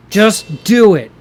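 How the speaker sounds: hammed-up motivational shout, drawn out; Shia LaBeouf impression. Fan urging the wrestlers to act, in a slow loud mock-motivational shout. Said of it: "Shia LaBeouf".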